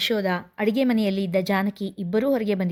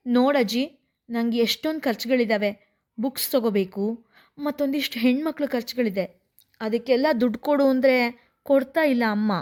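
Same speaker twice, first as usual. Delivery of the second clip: ordinary delivery, nothing marked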